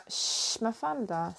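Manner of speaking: soft shush, then the dog's name hushed; hushed aside to the dog; hushing the dog. Real, not acted. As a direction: quietly call the dog to order.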